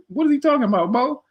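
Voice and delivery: high-pitched retold questioning; puzzled challenge. Man turning to Bo for an explanation, baffled challenge. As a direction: press him, high and baffled.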